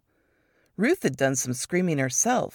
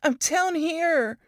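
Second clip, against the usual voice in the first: Ruth calling up; 12 semitones higher than usual. A narrator's reading. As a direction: call up, hurt and winded.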